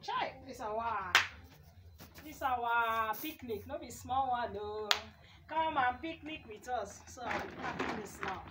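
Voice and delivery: ordinary talk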